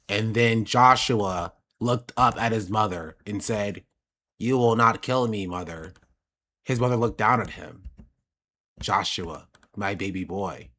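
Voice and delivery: flat, low, slow audiobook read; monotone narrator. Retold or imitated. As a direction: drone it like a dull narrator. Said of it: "a monotone voice being like".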